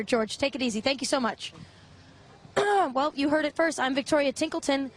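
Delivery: ordinary talk, nothing marked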